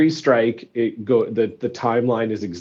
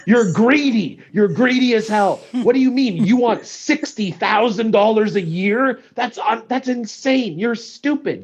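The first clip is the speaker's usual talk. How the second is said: mock furious yelling, pitched way up; angry parents; 9 semitones higher than usual. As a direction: parents screaming at striking teachers, high mock rage. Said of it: "and then they're like".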